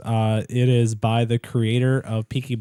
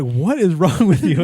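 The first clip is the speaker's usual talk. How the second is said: higher, louder imitation of a baffled wife; mock exasperation; 5 semitones higher, 6 dB louder than usual. Wife questioning her crying husband, retold with mock exasperation. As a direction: retell it as an exasperated demand.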